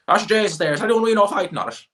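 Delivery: high, loud, fast Midlands Irish voice; hammed-up Irish country accent; mocking rural Irish accent. Mocking Irish Midlands locals asking who's fighting, high, loud country accent. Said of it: "They'd be like".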